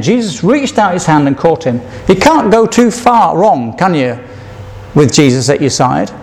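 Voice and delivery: ordinary talk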